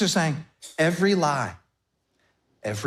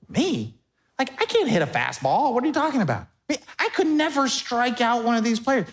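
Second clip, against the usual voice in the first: higher, flustered surprise; mock disbelief; 7 semitones higher than usual. Pastor voicing a shocked fan, raised and amazed. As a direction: play it stunned and humble. Pastor voices stunned honoree.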